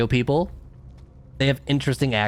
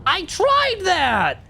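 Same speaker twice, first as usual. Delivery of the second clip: drawn-out high frustrated wail; exasperated cry; much slower than usual. Real, not acted. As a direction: stretch it out in pure exasperation. Frustrated gamer yelling at game.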